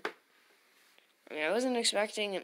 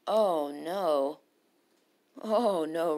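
ordinary talk

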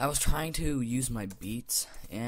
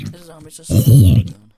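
louder, faster mock insult; mock shout; 22 dB louder, faster than usual. Gamer yelling a mock insult at the zombie, loud and fast. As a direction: yell it at the zombie.

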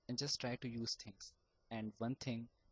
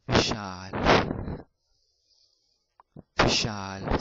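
louder, pushed out on one big exhale; breath-release demo; 2 semitones lower, 15 dB louder, much slower than usual. Shown as a demonstration. Teacher showing stammerers: name blown out on a louder exhale. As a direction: let the name ride a released deep breath. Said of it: "with releasing the deep breath, you can say Vishal like that".

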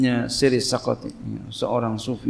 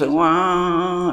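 raised, drawn-out imitation wail; mock child crying; 6 semitones higher, 3 dB louder than usual. Child crying while being taught patience, mock wail. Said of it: "Anaknya nangis".

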